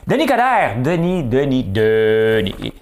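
high, loud mock hailing; calling out. Comedian hailing a politician he teases, mock shouting. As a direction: holler the name, dropping from a high start.

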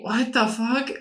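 normal talking voice

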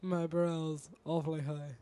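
mock Russian accent, slow and laughing; lofty Russian accent, slowly. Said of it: "My brows".

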